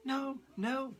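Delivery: put-on high, urgent denial from the girl; sudden burst of life. A narrator's reading. Girl to Leonardo, suddenly alive, high urgent denial. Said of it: "she seemed to come suddenly alive".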